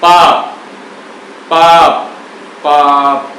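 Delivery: पाप with lips pressed shut at the end, no vowel after the last प; closed final syllable. Shown as a demonstration. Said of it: "अब बोल के देखिए पाप शब्द को". (पाप, अंत में होठ चिपके रहें)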